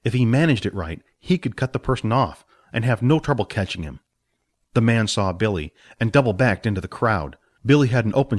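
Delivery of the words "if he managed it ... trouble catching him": ordinary delivery, nothing marked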